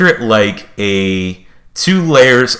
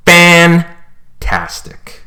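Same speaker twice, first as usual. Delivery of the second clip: drawn-out delight; 10 semitones higher, 4 dB louder, much slower than usual. Acted.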